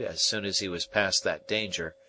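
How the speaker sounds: ordinary delivery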